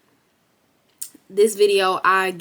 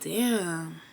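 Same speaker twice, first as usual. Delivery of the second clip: soft, breathy whisper to herself, much softer; deflated whisper; 8 dB quieter, much slower than usual. Retold or imitated. Comparing herself to a bigger channel, a deflated whisper. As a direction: let the words sink under your breath, defeated. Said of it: "or I'm gonna feel like".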